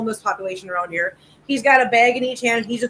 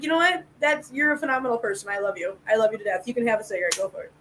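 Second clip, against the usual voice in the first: soft tender reply; 4 semitones lower, 8 dB quieter than usual. Retold or imitated. Telling homeless man she loves him.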